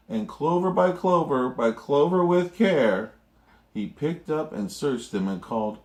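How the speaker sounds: ordinary delivery, nothing marked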